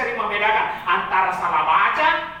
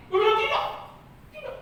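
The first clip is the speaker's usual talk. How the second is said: refusing to yield; 9 semitones higher, much faster than usual. Acted. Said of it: "Tidak ada yang mau mengalah".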